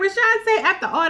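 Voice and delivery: can't believe the name you just heard; shocked disbelief. Real, not acted. Shocked at the choice.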